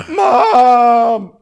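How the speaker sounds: loud, high laughing cry; mock wail. Kid crying for mom, loud mock wail.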